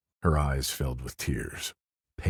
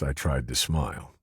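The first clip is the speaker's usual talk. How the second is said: ordinary delivery, nothing marked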